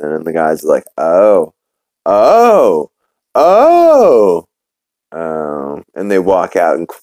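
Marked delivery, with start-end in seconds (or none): 1.0-4.5 oh's building from plain to loud and high; imitating dawning realization. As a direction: escalate each drawn-out oh into a howl. Guys realizing, each oh bigger.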